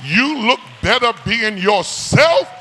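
high, loud climactic preaching; shouted call to be yourself; full-force preaching. Acted. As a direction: drive it home to the church.

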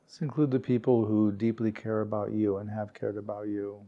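calm, unhurried meditation guide, words spaced by long pauses; guided meditation. Real, not acted. Meditation teacher guiding warm-hearted peers to relax, calm and unhurried. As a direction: gently lead a sitting group into stillness, leaving space.

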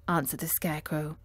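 plain reading voice

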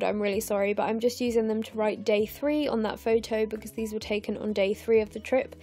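ordinary talk

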